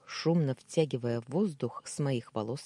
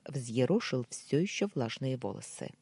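plain reading voice

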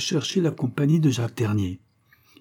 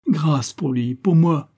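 pleading cry; 5 semitones higher, 4 dB louder, slower than usual. Acted. Denise begs for mercy.

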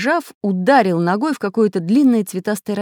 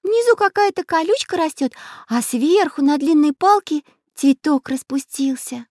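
put-on much higher, delighted girl voice; little girl voice; 11 semitones higher than usual. A narrator's reading. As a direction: squeal like an excited little girl. Little girl Nastenka speaks.

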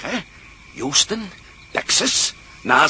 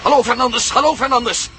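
higher, louder, insistent calling; urgent radio call; 5 semitones higher, 4 dB louder than usual. Acted. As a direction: shout into the set for an answer. Calling over the radio, growing urgent. (dringend roepen via de radio naar Fernandes)

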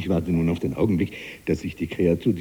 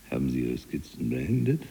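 ordinary talk

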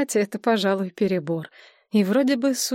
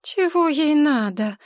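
slow sleepy groan; 6 semitones higher, slower than usual. Acted. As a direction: moan it, half awake. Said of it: "простонала Мария". (сонный стон)

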